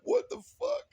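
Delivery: high, loud what the fuck; stunned disbelief. Retold or imitated. Comedian reacting to the drunk captain, shocked and loud. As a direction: exclaim in shock.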